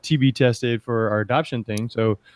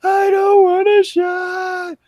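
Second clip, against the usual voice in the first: high, drawn-out wailing scream; imitated child screaming; 3 dB louder, much slower than usual. Dad imitating his child at the doctor's, screaming. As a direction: scream it like a kid dreading a needle, much higher and slow. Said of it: "Like, just screaming".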